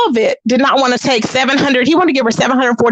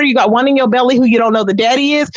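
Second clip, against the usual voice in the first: usual speaking voice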